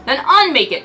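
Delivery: loud retort; shouted. Acted. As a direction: snap back loud. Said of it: "Tony shouted".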